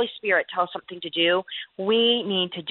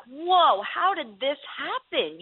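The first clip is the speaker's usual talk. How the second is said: slow wondering whoa, drawn out; amazed; 7 semitones higher, much slower than usual. Retold or imitated. Guest recalls the night's outcome, slow and amazed. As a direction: marvel at it slowly. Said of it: "I'm like".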